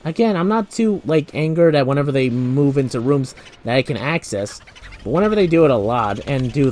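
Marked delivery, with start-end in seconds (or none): none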